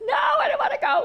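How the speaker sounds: high, laughing mock shout; baby eagle protesting. Baby eagle shouting back at the big eagle, high laughing protest. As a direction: yell the refusal like a panicked chick.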